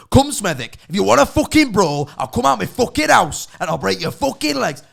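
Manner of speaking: screaming Brummie brawler, loud and high; Danny G. Retold or imitated. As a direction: bellow threats in a Birmingham accent. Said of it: "Danny G screaming about living in Birmingham".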